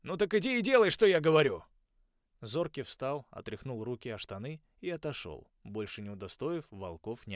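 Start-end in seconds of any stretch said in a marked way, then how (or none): 0.0-1.6 loud, high stern order; commanding shout. A narrator's reading. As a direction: drive the order home. Coach ordering beaten wolf.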